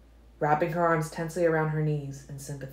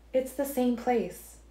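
higher, slow female voice for Alice; Alice speaking from a vision, higher and slower; Alice's voice; 9 semitones higher, slower than usual. A narrator's reading. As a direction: lift into Alice, slow and careful.

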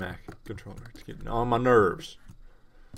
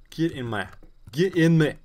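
loud, higher-pitched greedy cry; put-on voice, hammed up and loud; failed character impression; 5 semitones higher, 8 dB louder than usual. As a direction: belt it out like a hungry character. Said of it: "That doesn't even sound like him".

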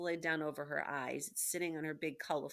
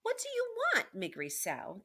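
very high, loud demand falling off on the name; raised-voice princess calling out her question; shouted; 4 dB louder than usual. A narrator's reading. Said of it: "the princess shouted".

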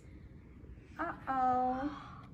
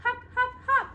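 much higher, bouncy hop-hop chant; hopping bunny; 12 semitones higher than usual. Acted. Parent voicing Honey hopping for the toddler, bouncy and high.

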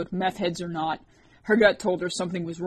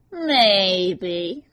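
dark nasal voice; 6 dB louder than usual. A narrator's reading. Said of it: "a dark, nasally voice".

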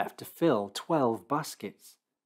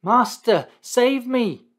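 much higher, much louder cry for help; desperate cry; 13 semitones higher, 10 dB louder, slower than usual. Acted. Sinking Peter cries out to Jesus, desperate high yell for help. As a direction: scream it as if sinking. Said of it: "cried out".

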